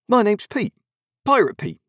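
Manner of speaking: bright, high, grinning pirate lad; cheerful pirate boy. A narrator's reading. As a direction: say it with a broad smile, proud of the name. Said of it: "his face broke into a broad smile".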